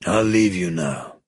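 controlled, unhurried menace; menacing crime boss. A narrator's reading. Villain takes leave of captives.

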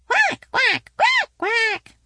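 very high, loud quacking; duckling quacks. A narrator's reading. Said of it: "as loud as their little quackers could quack".

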